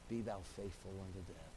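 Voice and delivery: hushed reading of Jesus' words; hushed scripture quote. Acted. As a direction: let the verse settle quietly.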